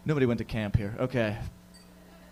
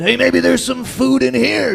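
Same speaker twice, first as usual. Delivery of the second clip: higher, louder, slow bear voice; curious bear; 9 semitones higher, 8 dB louder, slower than usual. Pretend. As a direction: poke into the tent like a hungry bear. Bear voiced sniffing for food.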